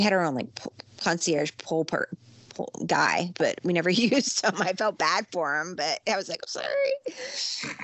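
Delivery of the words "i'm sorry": squeaky, very high little sorry; slow, stretched sorry in a tiny high voice; apologetic falsetto; sheepish high apology. Retold or imitated. Sheepish apology to pool guy.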